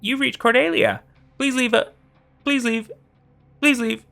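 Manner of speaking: high, looping cheery recording; glitching voicemail. Acted. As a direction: repeat like a skipping recording.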